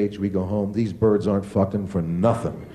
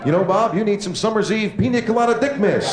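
mock dad; 9 semitones higher than usual. Dad voiced answering son.

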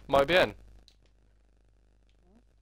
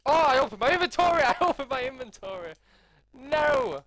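much higher, loud cry of dismay; excited panic; 13 semitones higher, 7 dB louder than usual. Real, not acted. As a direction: yelp at the game blunder. Game mishap, laughing.

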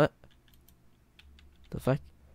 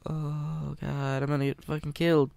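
ordinary delivery

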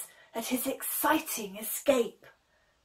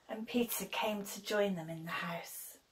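whispered narration; 3 semitones lower, 11 dB quieter than usual. A narrator's reading. Narrator hushes.

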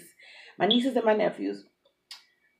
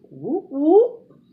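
excited whoop; 7 semitones higher, 9 dB louder than usual. Real, not acted. Hype call in intro.

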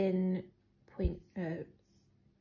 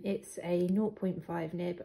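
normal talking voice

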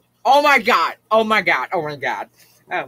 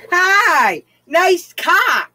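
mock cheer; 10 semitones higher, 4 dB louder, much slower than usual. Real, not acted. Mocking shout at stranger.

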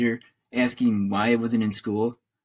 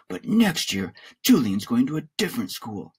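ordinary delivery, nothing marked

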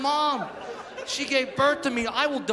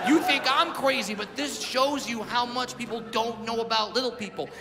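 ordinary talk

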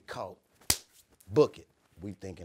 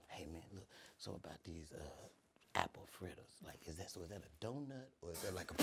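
quiet; 3 semitones lower, 17 dB quieter than usual. Retold or imitated. Teen quizzing manager about fritters.

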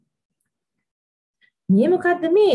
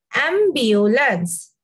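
"Ambulance" said very slowly, every syllable clear; repeat after teacher; much slower than usual. Shown as a demonstration. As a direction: model it slowly for children to echo. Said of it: "Listen carefully and pronounce after me".

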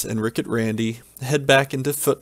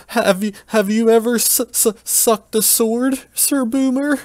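nervous stutter; 11 semitones higher than usual. Acted. Nervous Peter stutters a question to the Dark Knight. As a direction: stumble over the words, nervous. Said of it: "starts to stutter".

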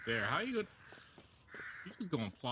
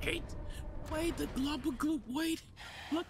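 high eager wait; excited; 13 semitones higher than usual. Real, not acted. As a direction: squeak with sudden hope. Excited at the goop.

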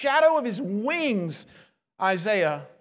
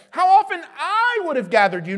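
a loud, pleading cry from Jesus; voicing Jesus, raised; 5 semitones higher, 7 dB louder than usual. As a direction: call it out as Jesus lamenting. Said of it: "That's why Jesus says".

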